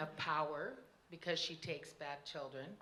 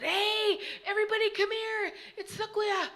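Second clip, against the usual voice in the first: high, loud calling out in alarm; frightened shout; 11 dB louder than usual. A narrator's reading. Little girl calling the children over, high alarmed shout. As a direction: yell for everyone to come, scared.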